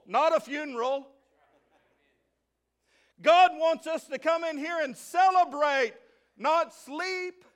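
loud, high preaching call to the church; rally the church, ringing out; preaching at full force. Real, not acted. Preacher shouting to congregation.

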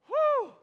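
high, loud whistle-like whew; excited whew. Real, not acted. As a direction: let out a big joyful whew. Reliving the wild game end.

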